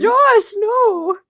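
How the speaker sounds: high, loud despairing no; mock scold. Real, not acted. Reader scolding the character Josh, loud and high. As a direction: cry out at the character.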